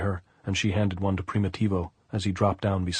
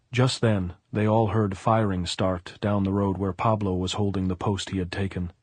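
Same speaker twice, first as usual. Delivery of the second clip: ordinary delivery, nothing marked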